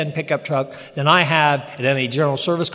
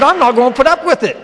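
mock indignant outburst; 7 semitones higher, 6 dB louder than usual. Indignant retort to wife.

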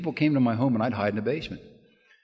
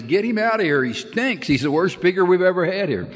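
high-pitched mock yell, mostly a little louder; retold heckler's yell; 10 semitones higher, 4 dB louder than usual. Heckler at the back yells at the new speaker, mock yell. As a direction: yell it out like a heckler imitated in a story. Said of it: "the guy in the back yells out".

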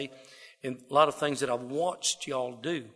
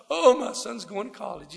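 higher, beaming proud dad voice; proud father bragging; 6 semitones higher, 3 dB louder than usual. Retold or imitated. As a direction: brag about your son, lightly mocking.

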